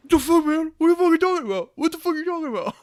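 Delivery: loud, high, slurred indignant yelling; what the fuck, outraged and loud; drunk angry outburst. Retold or imitated. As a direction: bark it like an offended drunk. Drunk friend angrily denies.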